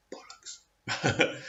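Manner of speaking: muttered under the breath, dismissive; whispered scoff. Real, not acted. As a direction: whisper it with contempt. Scoffing aside during the reading.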